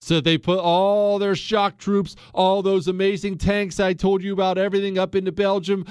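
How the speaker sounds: ordinary talk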